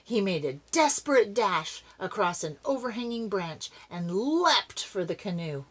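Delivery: ordinary delivery, nothing marked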